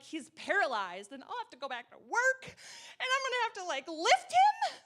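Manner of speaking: retold panic climbing high and loud; freaking out. Wife fearing husband's surgery goes wrong, mock panic. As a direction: let the worry spiral up to a loud question. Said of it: "I am freaking out".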